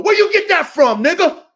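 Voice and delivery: loud, high confrontational shout; indignant challenge. Real, not acted. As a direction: burst out, demanding an answer. Confronting the man who copies him.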